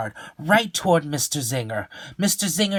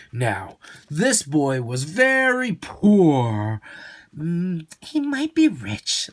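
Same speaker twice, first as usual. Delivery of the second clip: slow storytelling; 4 semitones higher, 4 dB louder, much slower than usual. Acted.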